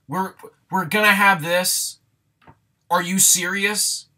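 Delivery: loud, high-pitched disbelief; incredulous. Real, not acted. Reviewer balking at the dog subplot, incredulous.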